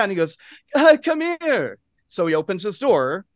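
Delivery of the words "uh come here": much higher, slow, mock Russian; high, slow, badly faked Russian accent; put-on accent. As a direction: beckon in a hammed-up foreign voice. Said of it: "I don't do accents".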